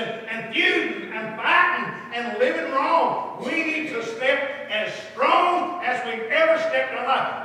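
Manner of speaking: loud, drawn-out preacher's cadence; fervent preaching. Acted. Preacher warning the congregation about the world, fervent preaching. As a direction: hammer the key words with rising fervor.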